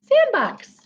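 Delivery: high delighted sandbox; excited. Acted. Reader delighting the child with the sandbox. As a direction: sing it out with delight.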